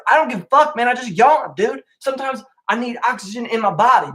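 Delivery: high, worked-up complaint; exasperated rant. Real, not acted. Vlogger venting at people who mock his yawning, worked up. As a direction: vent at the guy who mocked the yawn. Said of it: "ranting at the bro".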